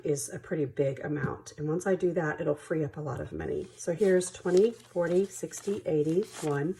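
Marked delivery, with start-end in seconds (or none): none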